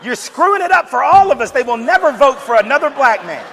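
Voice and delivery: high, loud mock yell at the TV; frustrated yelling. Viewers yelling at the president, high loud mock yell. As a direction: scold him through the screen, loud and high.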